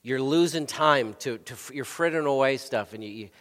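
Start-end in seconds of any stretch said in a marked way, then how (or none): none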